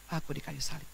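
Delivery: ordinary delivery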